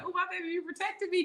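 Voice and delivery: high, soft swoon; a touch of mock swooning. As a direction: coo at the protector. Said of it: "'Cause I'm like".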